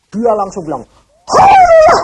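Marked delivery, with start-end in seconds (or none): 1.3-2.0 very high, loud exultant hallelujah; joyful cry. Retold or imitated. Freed prisoner crying out to God, high and loud. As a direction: burst out in ecstatic praise.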